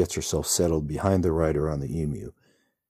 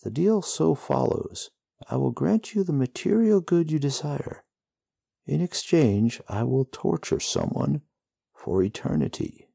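whimpering devil's words; 10 semitones higher than usual. A narrator's reading. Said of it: "words that whimper loud".

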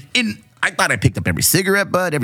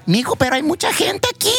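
high, worried grandma voice, lightly voiced; a grandma fretting about the crowd in the house; worried abuelita. Grandmother to her grandson about the party guests, worried.

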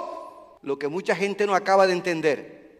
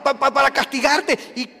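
usual speaking voice

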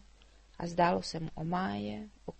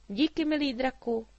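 put-on louder, high girl calling out; Mája calls farewell to the dragon; calling goodbye; 6 semitones higher, 7 dB louder than usual. A narrator's reading. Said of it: "zvolala na rozloučenou". (hlasité dívčí zvolání na rozloučenou)

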